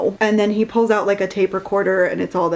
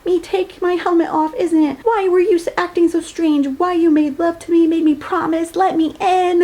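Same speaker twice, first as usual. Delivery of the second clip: melodramatic accusation; 9 semitones higher than usual. Retold or imitated. Retold accusing book character.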